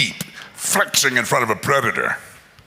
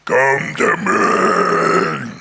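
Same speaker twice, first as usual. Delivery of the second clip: low, very slow booming call; slow giant taunt; 7 semitones lower, much slower than usual. Acted. Goliath calls David down, low and very slow. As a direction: drag it out like a giant in a valley. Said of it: "the Philistine said to David".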